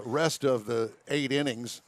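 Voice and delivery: ordinary talk, nothing marked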